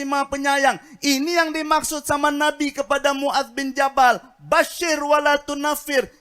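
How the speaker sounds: tender comfort turned into a booming call from the pulpit; fiery preaching. Acted. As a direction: preach it out at full force to the crowd. Preacher rises into full sermon.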